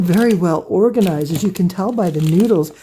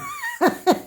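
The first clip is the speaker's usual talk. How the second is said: very high laughing burst; amused laugh; 4 dB quieter than usual. Real, not acted. Joking about her own scraps in a bin, high amused laugh.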